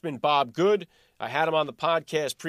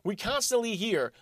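usual speaking voice

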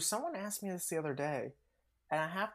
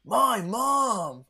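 higher, louder character shout; imitated cartoon yell; 7 semitones higher, 7 dB louder than usual. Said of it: "he, he yells".

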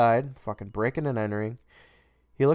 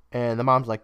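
ordinary talk, nothing marked

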